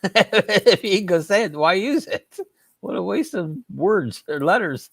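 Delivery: high, amused laugh through the words. Real, not acted. Joking with the chat about silent letters, laughing. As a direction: laugh while protesting, pitched well up.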